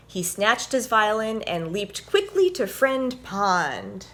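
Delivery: animated picture-book read-aloud; reading to children. A narrator's reading. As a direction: read it like story time for little ones.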